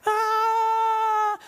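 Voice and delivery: high, loud wild shout; crazy yell. Retold or imitated. Preacher acting out a bass pro celebrating a catch. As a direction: go bananas with one big yell. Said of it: "He goes crazy".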